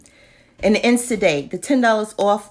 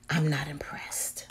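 whispered verdict; 4 semitones lower, 8 dB quieter than usual. Real, not acted.

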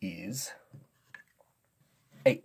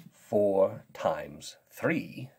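ordinary talk, nothing marked

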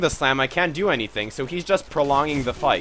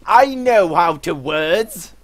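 slow, pompous British gentleman; drawn-out upper-class disdain; posh British accent; 4 semitones higher, 5 dB louder, slower than usual. Acted. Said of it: "My throat's dying from this accent".

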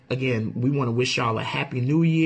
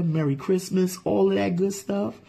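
normal talking voice

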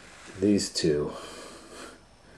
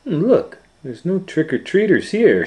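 much higher, louder nervous Shaggy; 12 semitones higher, 6 dB louder than usual. Acted. Shaggy notices empty streets, high nervous voice. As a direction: sound like a jittery Shaggy. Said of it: "Shaggy said".

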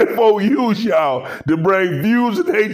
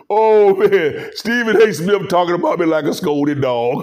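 usual speaking voice

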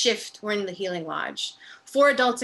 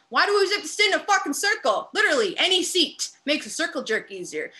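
angry; 6 semitones higher, 5 dB louder than usual. Acted. Inmate complains loudly.

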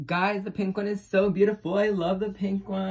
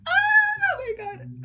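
high excited squeal; 7 dB louder, much slower than usual. Real, not acted. Opening another gift.

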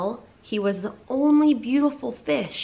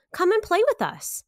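high calling voice; 9 semitones higher, 4 dB louder than usual. A narrator's reading. Other fish call to him.

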